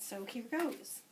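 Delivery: usual speaking voice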